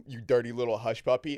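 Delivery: put-on loud jokey American accent. Host translating Australian flirting, loud mock American accent. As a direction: play up an American version of the pet name. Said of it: "If you said that with an American accent".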